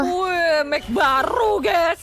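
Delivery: high, loud teasing gush; teasing excited exclaim. Real, not acted. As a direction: gush over it to tease her. Teasing friend about new mic.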